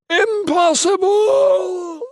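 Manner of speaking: long, high, loud monster scream. A narrator's reading. Monster wounded by the sword, disbelieving scream. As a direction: scream it out, stretched and piercingly high. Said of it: "Mickig screamed".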